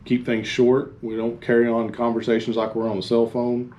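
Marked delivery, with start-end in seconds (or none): none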